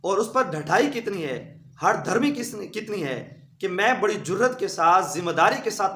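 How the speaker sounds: usual speaking voice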